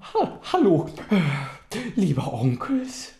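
high yawning voice; sleepy yawn. Acted. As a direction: yawn the greeting. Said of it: "gähnte er".

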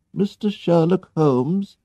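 a hint of a smiling old servant at the door. A narrator's reading. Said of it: "she asked, and smiled".

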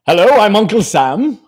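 a lightly put-on hammed-up government voice; goofy Uncle Sam. Uncle Sam announcing help to workers, lightly put on. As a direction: play a cheesy official greeting the public. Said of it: "this is not how Uncle Sam sounds".

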